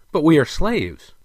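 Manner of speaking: high, loud protest; protesting retort. A narrator's reading. Prince retorts to the king, protesting. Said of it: "retorted with less defiance than before".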